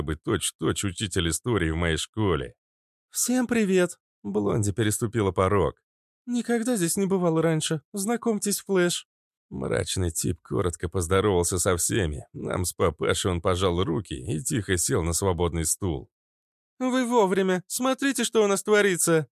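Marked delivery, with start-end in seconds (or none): 3.1-9.1 put-on higher, bright young woman; cheerful woman voice. A narrator's reading. Blondie greets. 16.8-19.3 put-on much higher, eager young girl; excited girl voice. A narrator's reading. Fitilyok excited.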